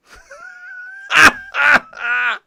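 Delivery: real, high, loud laugh; can't stop laughing at the memory; high wheezing laughter. Real, not acted. Streamer laughing at his friend's embarrassment, high loud laughter.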